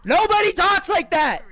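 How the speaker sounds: high, loud outburst; real irritated yell; exasperated shout. Real, not acted. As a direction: shout in disbelief at the character. Yelling at Stella's speech.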